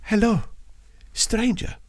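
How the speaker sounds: put-on high, lightly accented greeting; a lifted, lilting hello, only half a put-on voice; imitated Indian lady.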